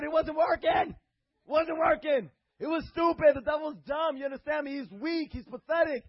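high, loud fiery preaching; preaching at full force. Acted. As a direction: preach it like thunder.

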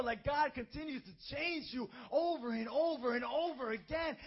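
loud, high preaching climax building to a shout; full-force gospel preaching. Acted. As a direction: preach it with rising fire.

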